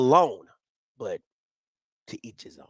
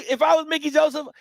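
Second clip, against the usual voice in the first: ordinary talk, nothing marked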